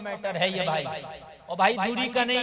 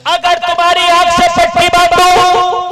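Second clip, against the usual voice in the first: higher, louder, roaring sermon; shouted full-force preaching; 8 semitones higher, 18 dB louder than usual. Acted. Preacher roaring to the gathering about the grave, shouting. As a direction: shout it over the crowd.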